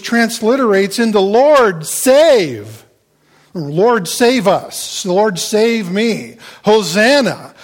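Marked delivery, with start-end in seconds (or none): 1.2-7.5 loud, higher, slow cry to God; emphatic, drawn-out appeal for rescue; emphatic plea. Shown as a demonstration. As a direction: stretch each word in a forceful plea. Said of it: "Hosanna is an emphatic statement".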